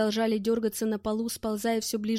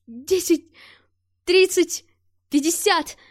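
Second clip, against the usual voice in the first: loud, rising, higher count; excited shouting; 7 semitones higher, 8 dB louder, slower than usual. Acted. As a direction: call out each number triumphantly. Pilot counts rising speed. (возбуждённый крик)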